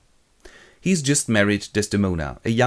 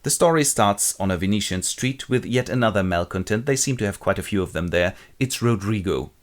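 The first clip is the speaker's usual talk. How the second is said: ordinary delivery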